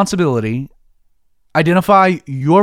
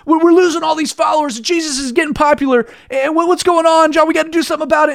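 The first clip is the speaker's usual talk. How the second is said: higher, fast, panicky retelling; mock freaking out; 7 semitones higher, faster than usual. Disciples panicking to John about losing followers, mock frantic. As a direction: play the disciples' alarm, half-hammed. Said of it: "they're freaking out".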